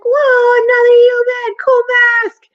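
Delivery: put-on loud, very high excited kid; awed little boy, voice way up; amazed ghost boy. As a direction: shout it like a thrilled child. Ghost boy amazed by another human.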